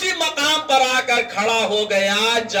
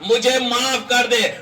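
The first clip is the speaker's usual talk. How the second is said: high, sustained acted plea; urgent high-pitched supplication of a drowning man; desperate prayer; 6 semitones higher than usual. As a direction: raise the pitch and beg for forgiveness. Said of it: "دعا کرنے لگا".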